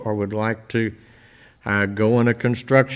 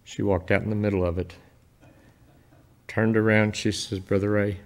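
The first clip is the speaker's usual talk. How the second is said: much softer, holding back tears; emotional, hushed; 2 semitones lower, 7 dB quieter than usual. Real, not acted. Retelling a lady's classroom to the church, moved and hushed. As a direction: tell it gently, moved. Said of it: "I get emotional talking about this".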